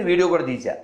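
ordinary talk, nothing marked

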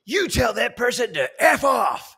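high, slow, emphatic mock shout; loud, drawn-out outburst; Steve Hardison shouting. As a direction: bellow each word in retold indignation. Retold indignant reply from Steve.

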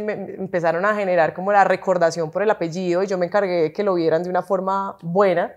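normal talking voice

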